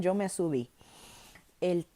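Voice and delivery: ordinary talk, nothing marked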